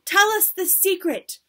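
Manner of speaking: high, loud demand; impatient cry. A narrator's reading. Audience pressing their leader, impatient cry. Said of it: "cried the audience impatiently".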